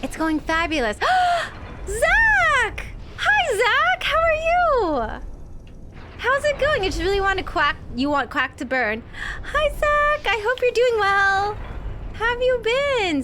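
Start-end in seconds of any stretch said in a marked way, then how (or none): 1.0-1.8 high, delighted gasp; happy high gasp of recognition; excited gasp. Real, not acted. Sees friend Zach in chat. 1.9-13.2 overjoyed high-pitched welcome to a friend; excited greeting. Real, not acted. Greeting friend Zach on stream.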